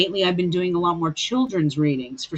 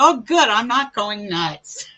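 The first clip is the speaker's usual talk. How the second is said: slow, loud laughing relief; retelling her relief to the boy, laughing loudly; relieved laugh; 4 semitones higher, 5 dB louder than usual. Retold or imitated. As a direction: laugh it out, relieved you're sane. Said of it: "I go".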